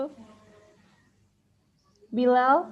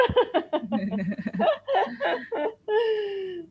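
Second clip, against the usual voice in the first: long, high burst of laughter; real laughter; 7 semitones higher, 4 dB quieter than usual. Real, not acted. Host laughing after teasing the participants, amused laugh. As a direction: laugh it out at length.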